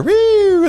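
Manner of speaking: high, loud imitated police siren wail; siren imitation. Little kid mimics the police siren, high loud wail. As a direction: sing out a siren's wee.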